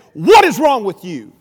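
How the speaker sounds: loud, slow demand, retold as the wife; acted scolding with weight on each word; stern confrontation. Wife confronts preacher after a dead Sunday service, stern acted scolding.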